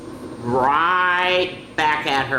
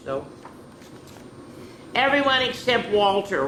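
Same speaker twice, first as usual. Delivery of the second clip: ordinary delivery, nothing marked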